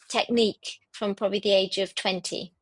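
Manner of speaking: usual speaking voice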